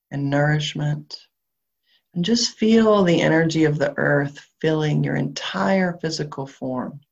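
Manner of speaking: calm grounding visualization, unhurried; guided meditation. Acted. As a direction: guide them gently down into the body.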